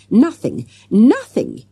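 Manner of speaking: loud, emphatic repetition. A narrator's reading. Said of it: "raising his voice above Jessica's".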